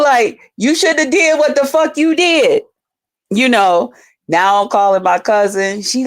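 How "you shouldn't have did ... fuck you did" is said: high, defiant yelling back; mock angry yell. Her younger self yelling at him from behind the locked door. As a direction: shout it through the bathroom door. She repeats yell from bathroom.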